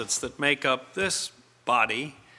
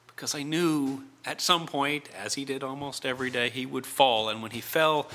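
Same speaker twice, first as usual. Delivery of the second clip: ordinary delivery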